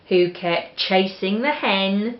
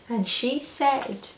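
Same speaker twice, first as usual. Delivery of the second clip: ordinary delivery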